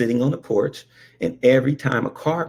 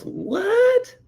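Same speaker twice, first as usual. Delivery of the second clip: put-on much higher surprised kid; squeaked what. Brother shocked at the correction, high squeak. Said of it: "he goes".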